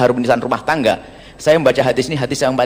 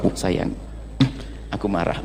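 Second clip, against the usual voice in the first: gentle husband; 7 semitones lower, 11 dB quieter than usual. Retold or imitated. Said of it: "Karena dia seorang yang solehah".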